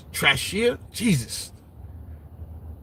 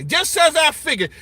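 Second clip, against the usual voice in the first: higher, sneering read of a know-it-all commenter; mocking high voice; 7 semitones higher than usual. Streamer mocking a chat commenter, high sneering voice.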